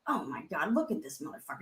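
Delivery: low muttered disgust under the breath; seething mutter. Retold or imitated. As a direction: grumble it at him through your teeth. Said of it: "So if your thoughts are".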